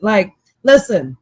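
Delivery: usual speaking voice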